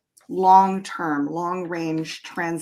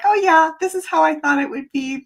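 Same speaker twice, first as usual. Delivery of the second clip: excited; 8 semitones higher, 5 dB louder, faster than usual. Retold or imitated. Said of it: "it always feels like we're like".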